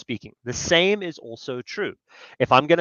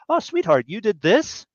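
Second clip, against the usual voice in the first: higher, sweet and pleased; sweet affectionate tone; 7 semitones higher than usual. Shown as a demonstration. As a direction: say it like a delighted wife. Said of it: "Those are the same words, but they mean very different things".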